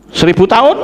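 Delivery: TAHUN rising high as a question; questioning echo. Acted. Preacher queries the sentence, "tahun" rising in disbelief. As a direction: lift "tahun" sharply into a question.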